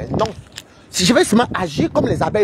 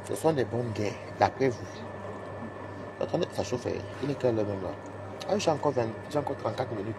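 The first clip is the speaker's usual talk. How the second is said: low and soft so the office won't hear; quiet talk in the office corridor; hushed at the office; 7 semitones lower, 11 dB quieter than usual. Real, not acted. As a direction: keep it down, coworkers nearby. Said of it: "on ne parle pas fort".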